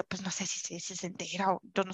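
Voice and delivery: a hushed, retold inner doubt; inner worry. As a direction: voice the worry almost under your breath. Recalling her private worry.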